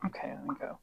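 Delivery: unvoiced, hushed whisper. Real, not acted. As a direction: whisper it aside. Whispered aside.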